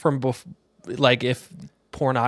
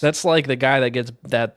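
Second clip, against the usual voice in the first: ordinary talk, nothing marked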